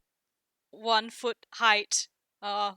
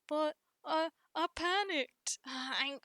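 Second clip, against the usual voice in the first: higher, halting excuse; Boulder; 5 semitones higher, 3 dB quieter than usual. Acted. Boulder explains himself to berating brother, faltering and higher. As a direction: stammer out a meek defence. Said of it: "Boulder being like".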